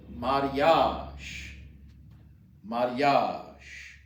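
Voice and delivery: "mariage" said mah-ree-AHZH, falling; pronunciation model. Shown as a demonstration. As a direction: model "mariage" twice for learners. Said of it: "Marriage is".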